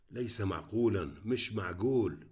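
ordinary delivery, nothing marked

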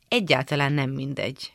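usual speaking voice

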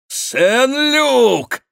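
very high, loud cry of joy; joyful exclamation. A narrator's reading. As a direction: cry out the friend's name, high. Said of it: "воскликнул он". (громкий радостный возглас)

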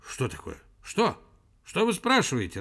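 mildly irritated, pitch climbing. A narrator's reading. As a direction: demand to know what was asked. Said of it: "раздражился Персиков".

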